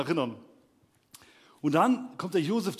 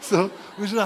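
ordinary talk, nothing marked

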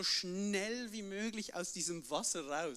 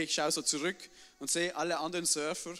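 normal talking voice

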